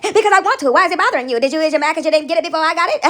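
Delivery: loud, playful clapback; mock defiant taunt. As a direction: fire back at the critic, loud. Taunting back at a critic.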